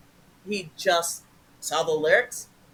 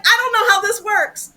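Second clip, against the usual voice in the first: baffled; 12 semitones higher, 8 dB louder than usual. Retold or imitated. Retelling own baffled reply.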